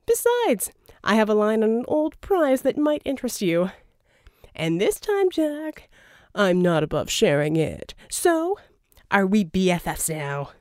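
a playful, slightly unhinged villain; Verdigris voiced. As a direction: dangle the prize with relish. Verdigris tempting Jack.

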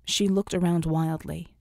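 plain reading voice

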